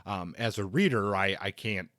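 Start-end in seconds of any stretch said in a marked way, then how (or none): none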